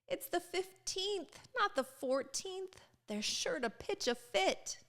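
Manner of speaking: high, soft, anxious little voice; worried Ruby. Acted. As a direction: fret quietly to the bird.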